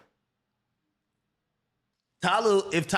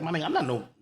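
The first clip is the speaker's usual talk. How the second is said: ordinary talk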